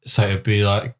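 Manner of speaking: ordinary talk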